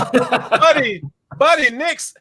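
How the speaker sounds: high-voiced mock indignation; high-pitched voice. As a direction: protest in a high, comic voice. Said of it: "your voice is almost as high".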